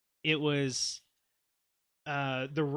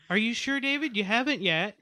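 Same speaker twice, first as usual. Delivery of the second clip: teasing; 8 semitones higher than usual. Real, not acted. Teasing friend about crashing.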